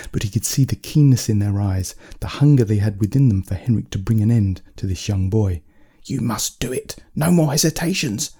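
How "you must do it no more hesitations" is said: witch crying out. Acted. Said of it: "one of the witches cry out".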